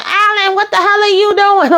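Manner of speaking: high-pitched imitation of screaming; retold scream, shot up in pitch; mock scream. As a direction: yell it way up high, imitating someone in a panic. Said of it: "you could hear Kyle screaming and saying".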